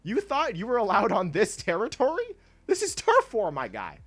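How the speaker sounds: hammed-up territorial challenge, voice jumping up high; mock outrage. Player warning rivals off the team's turf, mock outrage.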